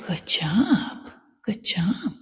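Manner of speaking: hushed whisper of praise. Real, not acted. Trainer softly praising the nervous dog, whispered. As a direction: breathe the praise softly to calm him.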